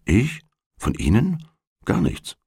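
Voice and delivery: soft, high rising questions, then a quiet dismissal; taken aback, voice lifting sharply on the questions; astonished. A narrator's reading. Gentleman to a young stranger asking his opinion, astonished. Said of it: "Der Herr sagt erstaunt".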